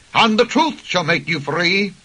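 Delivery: ordinary delivery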